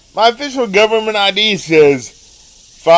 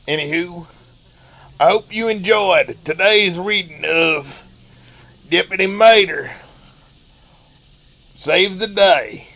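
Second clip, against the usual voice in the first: terrible Larry the Cable Guy. Acted. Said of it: "that was me doing a terrible Larry the Cable Guy impersonation".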